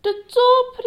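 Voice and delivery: high, loud excited cry; calling out. A narrator's reading. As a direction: cry out on sighting the goal. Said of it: "riep hij".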